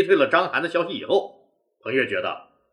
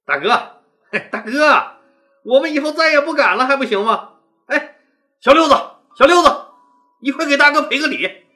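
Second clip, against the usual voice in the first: cheeky laughing plea, higher and louder; grinning, joking; 7 semitones higher, 5 dB louder than usual. Acted. Grinning men joke their way out with their leader, cheeky and loud. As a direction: laugh it off like it's no big deal. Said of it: "嬉皮笑脸的对彭越说".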